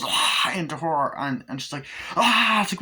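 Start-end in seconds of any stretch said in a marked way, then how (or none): 0.0-0.5 loud rushing demon noise; mock horror-film "aah"; imitated demon cry. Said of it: "And then the demon goes". 2.2-2.6 loud, high horror shriek; imitated piercing cry; mock scream. Possessed woman screams, retold.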